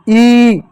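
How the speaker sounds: ई long and loud; long ई demo. Shown as a demonstration. Teacher showing children the long ई sound. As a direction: stretch out ई loudly. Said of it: "बड़ी ई का ध्वनि".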